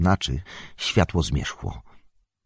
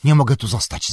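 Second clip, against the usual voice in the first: raised, louder, upset outburst; agitated cry; 10 semitones higher, 5 dB louder, faster than usual. A narrator's reading. Sam recoils from the mirror, agitated cry. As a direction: call out in agitation. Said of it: "zawołał wzburzony".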